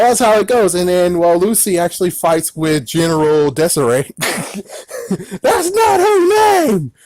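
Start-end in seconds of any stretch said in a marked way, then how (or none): 4.2-6.9 very high, drawn-out squeal; hammed-up falsetto objection; mock protest. Mock objection to own mistake.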